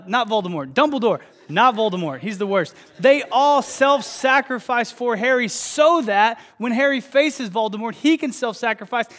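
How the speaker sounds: loud, rising preacher's appeal; preaching at full force. Acted. Pastor pressing the congregation, loud preaching. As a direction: drive it home to the crowd.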